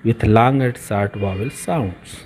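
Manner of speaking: ordinary talk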